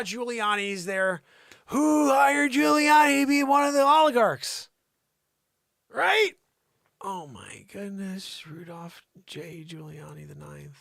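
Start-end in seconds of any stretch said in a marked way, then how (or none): 1.7-6.3 high, loud comic disbelief; hammed-up incredulous yell; mock outrage. 7.0-10.8 slow, hushed astonishment; hammed-up hushed disbelief; mock awe.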